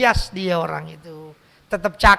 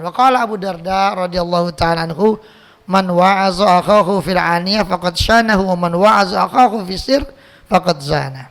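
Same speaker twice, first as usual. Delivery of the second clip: louder, measured Arabic quotation; Arabic recitation; 5 dB louder than usual. Acted. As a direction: cite the companion's words like scripture. Quotes Arabic saying.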